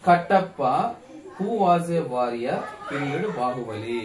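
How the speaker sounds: very slow, word by word example sentence; slow read-out. Shown as a demonstration. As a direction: read it out slowly for the class. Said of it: "చూడమ్మా".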